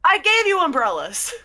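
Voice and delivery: high, loud indignant protest; exasperated retort. Acted. Exasperated cloud character answers player.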